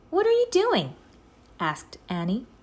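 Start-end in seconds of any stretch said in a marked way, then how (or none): 0.1-1.0 put-on very high, loud curious girl; high, loud little girl asking; Annie's voice. A narrator's reading. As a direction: pitch her way up, curious.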